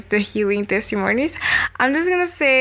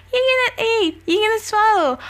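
incredulous; 11 semitones higher than usual. A narrator's reading. Wife doubting, narrated quote.

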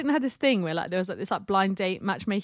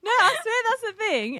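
usual speaking voice

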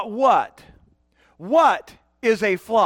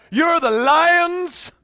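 mock grand declaration; much slower than usual. Pastor imagining a more flattering verse, mock grand. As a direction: proclaim it grandly and slowly, playfully. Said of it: "would have been great if".

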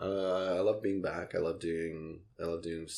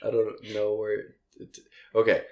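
louder, laughing through the words; 4 semitones higher, 8 dB louder than usual. Real, not acted. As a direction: talk while still giggling. Host laughing after the nervous laughter remark.

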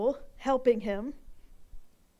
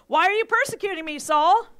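loud, high, fast divine rebuke; booming voice of God; 5 semitones higher, 8 dB louder than usual. Acted. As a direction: thunder the question down from heaven. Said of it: "the voice says".

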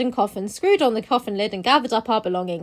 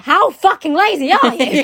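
loud, high exasperation; exasperated outburst; 5 semitones higher, 8 dB louder than usual. Retold or imitated. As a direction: scold the author. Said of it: "I was like".

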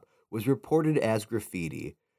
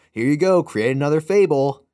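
loud, higher parody line; loud mock lyric; 4 semitones higher, 8 dB louder than usual. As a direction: belt the lyric mockingly. Lyric bit sung loud.

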